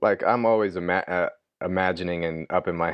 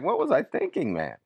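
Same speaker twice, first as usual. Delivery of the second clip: incredulous; 8 semitones higher than usual. Retold or imitated. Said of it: "I was like".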